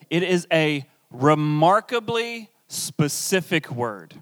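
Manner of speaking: ordinary delivery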